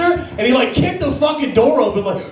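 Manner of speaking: ordinary delivery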